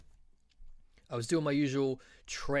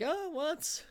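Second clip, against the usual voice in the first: high squeaky hammed-up "Oh, what?"; mock surprise. Hearing the dealer's wild claims, hammed-up high surprise. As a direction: leap up high in fake astonishment.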